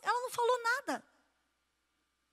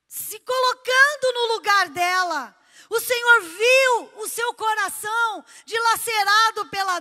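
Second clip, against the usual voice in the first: loud impassioned preaching, rising to a cry; fervent preaching; 2 semitones higher, 7 dB louder than usual. Acted. Pastor preaching to the church, impassioned and loud. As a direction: preach it out full force to the congregation.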